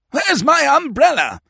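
put-on high, outraged young man; squawking upper-crust indignation; indignant demand. A narrator's reading. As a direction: demand it back in high dudgeon. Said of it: "demanded the pink one".

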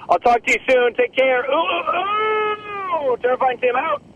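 high-pitched, hammed-up farewell with a howl; hammed-up sign-off. A comic character signing off a message to listeners, high mock howl. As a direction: call out a goodbye way up high, ending in a long ooh.

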